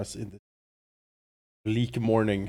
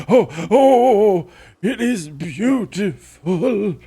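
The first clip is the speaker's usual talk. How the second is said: slow, high, trembling with wonder; awestruck old man; 9 semitones higher, 12 dB louder, much slower than usual. Retold or imitated. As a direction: gasp it out slowly in awe. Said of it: "You're like".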